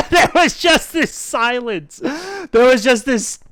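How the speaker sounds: slow, laughing through the words. Real, not acted. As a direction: chuckle as you recall it. Laughing while retelling.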